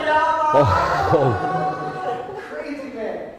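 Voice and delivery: very loud whooping yell with a laugh; thrilled skydiver shout; whooping joy. Retold or imitated. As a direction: cheer like you're fist pumping. Instructor fist pumping.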